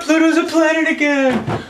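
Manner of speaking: high gushing mock squeal, first take; a hammed-up first shriek; mock fangirl. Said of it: "the big thing that I see on Tumblr".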